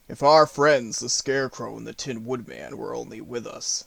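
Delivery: lightly voiced low lion; a hint of a lion character; Lion's voice. A narrator's reading. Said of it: "said the Lion".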